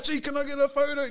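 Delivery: hammed-up drunk fan asking, voice lifted; imitating drunk fan. A drunk fan at the urinal begging for a photo, mock voice. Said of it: "they'll be like".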